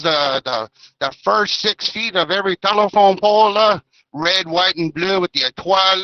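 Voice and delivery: lightly put-on Acadian French accent; a hint of Acadian French, teasing and playful; light mock Acadian French accent with lah tags. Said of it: "do my little French accent".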